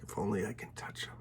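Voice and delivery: whispered hope, barely voiced; a desperate whispered plea to herself; hushed whisper. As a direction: breathe it like a secret wish. Woman's hopeful inner plea.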